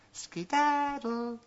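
high, slow, drawn-out dismissal; cartoon princess. A narrator's reading. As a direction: stretch it out, shooing him away.

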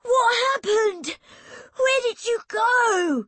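put-on very high, loud, drawn-out boyish questions; panting, urgent boy. A narrator's reading. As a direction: cry out desperately to a sister. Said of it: "he panted".

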